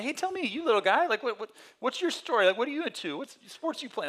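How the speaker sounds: high, loud, chummy questions; friendly homeless man. Retold or imitated. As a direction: draw the little guy out.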